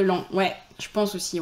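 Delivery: ordinary talk, nothing marked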